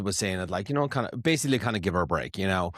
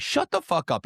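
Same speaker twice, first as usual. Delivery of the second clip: mock yell; 9 semitones higher than usual. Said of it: "you tell them".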